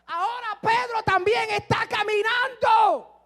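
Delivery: preacher's rising cry of wonder; excited shout. Acted. Preacher building to a climax.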